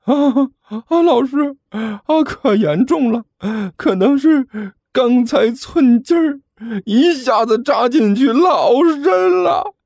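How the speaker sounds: high, slow sob rising to a wail; fake crying. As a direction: exaggerate the wound with sobs. 装伤向老师哭诉. (假装伤重，越哭越大声)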